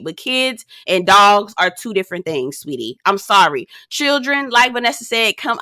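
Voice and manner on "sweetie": much lower and softer sweetie; soft mock-sweet put-down; dropped condescending sweetie. Real, not acted. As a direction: let it fall, patronizing. Condescending ending.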